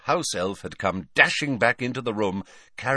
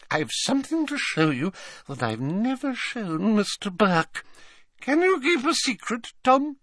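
put-on doting old lady; 3 dB louder than usual. A narrator's reading. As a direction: fuss over him like a grandmother. Old witch sharing a secret.

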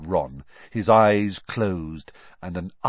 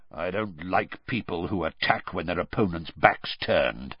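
low growl; Moody; 5 semitones lower, 4 dB quieter than usual. A narrator's reading. Moody punishes the ferret for a sneak attack, low growl. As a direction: growl it lower, menace held in. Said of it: "growled Moody".

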